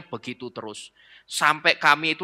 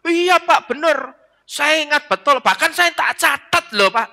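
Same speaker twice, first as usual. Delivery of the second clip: high, louder put-on voice of an eager congregant; chiming in; 12 semitones higher, 4 dB louder than usual. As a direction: blurt it out high and insistent. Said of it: "saling nyeletuk". (jemaat nyeletuk dengan suara tinggi dibuat-buat)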